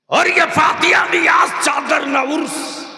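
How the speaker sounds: high, loud, slowing preacher's cry; fervent shout. Acted. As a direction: hammer the point to the crowd. Preacher shouts main point. (जोश में ऊँची पुकार)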